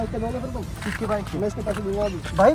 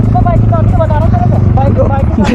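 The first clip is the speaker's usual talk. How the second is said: much louder, insistent voice; loud demand; 4 semitones higher, 9 dB louder than usual. Real, not acted. Man insisting on bike keys mid-quarrel, loud demand. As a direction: press the demand at high volume.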